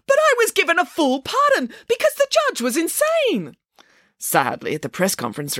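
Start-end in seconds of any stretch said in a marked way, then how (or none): 0.1-3.6 very high, outraged cry; yelling at the television. A narrator's reading. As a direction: yell back in indignant protest, pitch shooting up. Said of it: "started yelling at the television".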